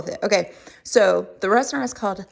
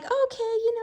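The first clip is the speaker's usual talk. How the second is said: mock polite; 13 semitones higher than usual. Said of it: "you're like".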